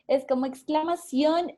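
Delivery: ordinary talk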